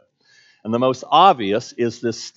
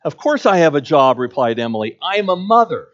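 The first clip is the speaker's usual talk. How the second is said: higher and louder, a touch proud; Emily answering the clerk, a little indignant; mildly indignant Emily; 3 semitones higher, 7 dB louder than usual. Acted. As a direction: stand your ground firmly.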